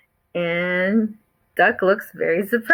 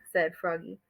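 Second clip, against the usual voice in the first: usual speaking voice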